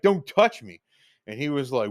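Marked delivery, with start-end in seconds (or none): 0.0-0.8 louder, higher "Don't touch me" barked as a warning; yelled warning. Retold or imitated. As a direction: snap "Don't touch me" at him, raised voice. Repeated warning, now yelled.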